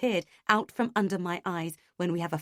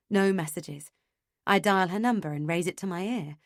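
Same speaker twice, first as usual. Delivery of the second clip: ordinary delivery, nothing marked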